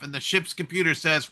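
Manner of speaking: ordinary talk, nothing marked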